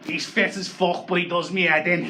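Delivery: put-on a Scouse girl's voice, slowed on fit as fuck. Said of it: "if that was a Scouse bird".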